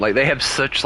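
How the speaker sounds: usual speaking voice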